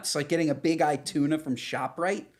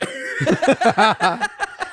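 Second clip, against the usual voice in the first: loud squealing laugh, pitched way up; high burst of laughter; 13 semitones higher, 7 dB louder than usual. Real, not acted. Laughing at her husband's joke, high squealing laugh.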